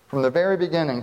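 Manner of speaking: ordinary talk, nothing marked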